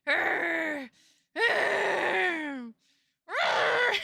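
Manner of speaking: exasperated growls climbing in pitch; hammed-up grr of outrage; mock angry growl. Mock rage ends in growls.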